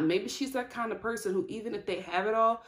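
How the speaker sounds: ordinary delivery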